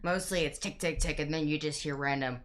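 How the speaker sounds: normal talking voice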